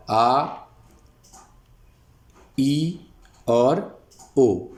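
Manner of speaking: slow आ, ई, ओ with ओ drawn out; vowel demo. Shown as a demonstration. Teacher sounding out the vowels आ, ई, ओ slowly. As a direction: hold each vowel long. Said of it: "जो वाव की आवाज़ है".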